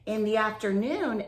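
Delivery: usual speaking voice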